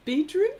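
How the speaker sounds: "bedroom" said as "beedroom", rising; Kiwi vowel. Shown as a demonstration. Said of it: "I heard Kiwis say".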